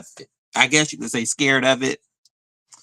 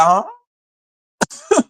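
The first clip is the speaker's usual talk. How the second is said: a squeaky, much higher confused huh; high baffled huh. Retold or imitated. Retelling how she reacted to her friend's denial, a high baffled huh. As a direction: sound completely thrown. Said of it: "I was like".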